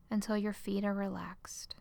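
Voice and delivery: normal talking voice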